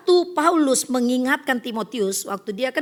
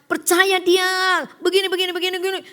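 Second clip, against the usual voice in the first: preacher keeps talking; 8 semitones higher, 5 dB louder than usual. Retold or imitated. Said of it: "Terus dia bilang".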